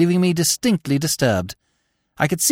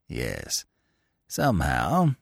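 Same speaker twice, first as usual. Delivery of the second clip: lower, softer smug voice of a sly rival; a lower, quieter oily cad; 14 semitones lower, 8 dB quieter, slower than usual. A narrator's reading. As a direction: drop low and purr it like a scheming bettor. Steggles voiced in narrated story.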